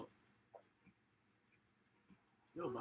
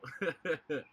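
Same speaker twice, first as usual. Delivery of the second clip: big loud laughing burst; 4 semitones higher, 11 dB louder than usual. Real, not acted. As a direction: crack up loudly. Knocked out in game.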